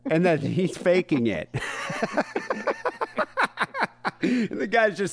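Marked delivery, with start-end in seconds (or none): 1.5-4.2 high laugh. Real, not acted. Laughs at the story.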